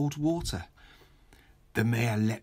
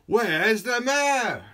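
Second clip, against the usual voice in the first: higher, louder, slow crowd cry; crowd calling; 11 semitones higher, 6 dB louder, much slower than usual. Acted. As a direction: call it out together like a crowd. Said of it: "asked the people in the crowd".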